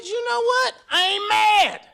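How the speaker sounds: high, loud defiant woman's voice; the wife snapping back, pitched high and loud. Retold or imitated. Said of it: "That woman said".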